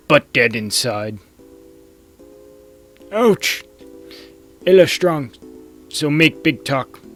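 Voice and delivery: high, loud broken-English caveman. Acted. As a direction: bellow it like a dopey cave elder. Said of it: "I can't do the caveman voice".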